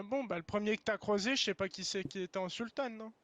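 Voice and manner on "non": usual speaking voice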